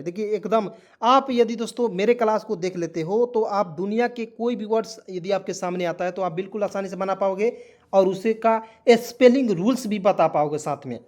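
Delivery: usual speaking voice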